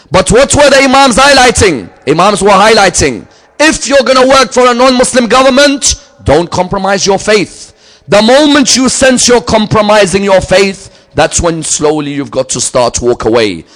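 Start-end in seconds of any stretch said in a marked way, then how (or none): 0.1-10.9 high, loud preacher's build; impassioned sermon climax; full-force preaching. Acted. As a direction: thunder it out to the congregation. Preacher building to final warning.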